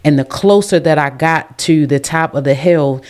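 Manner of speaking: ordinary talk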